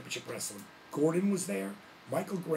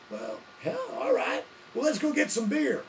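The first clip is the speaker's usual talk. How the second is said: much higher, louder hillbilly whoop; 12 semitones higher, 6 dB louder than usual. Retold or imitated. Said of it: "I said".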